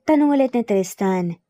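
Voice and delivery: plain reading voice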